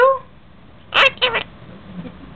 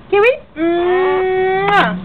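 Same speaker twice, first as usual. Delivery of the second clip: ordinary delivery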